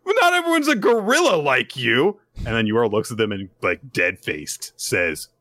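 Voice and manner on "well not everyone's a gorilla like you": high, loud mocking jab; snide retort. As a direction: sneer it in a sing-song. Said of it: "It's like".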